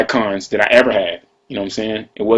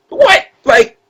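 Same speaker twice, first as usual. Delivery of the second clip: a shrill "What?" pitched way higher; outraged disbelief. Retold or imitated. Retelling Young Jock's reply about the Adidas, in a high squeal of disbelief.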